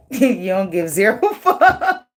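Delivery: words broken by laughter; laughing. Real, not acted. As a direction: laugh through the line. Laughing at reading.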